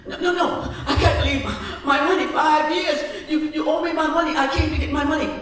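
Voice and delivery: high, frantic begging; high pleading voice, a little rushed; desperate pleading. Acted. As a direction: beg for the money back in a panic. Sweeper pleading for his money.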